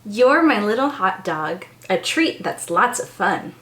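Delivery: gentle storybook reading; reading to children. Acted. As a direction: bedtime picture-book voice. Reads picture book aloud.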